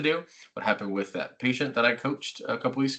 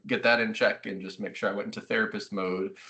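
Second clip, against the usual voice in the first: ordinary talk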